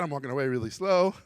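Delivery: usual speaking voice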